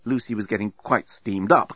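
usual speaking voice

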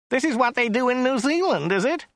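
high-pitched whiny complaint; whining. A narrator's reading. Driver complaining about reversing, high whine. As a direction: whine it high at your passenger. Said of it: "he whined".

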